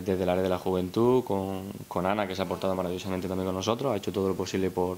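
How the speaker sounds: usual speaking voice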